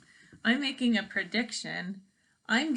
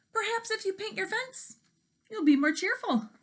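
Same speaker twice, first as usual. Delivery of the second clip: high hen voice; 12 semitones higher than usual. Acted.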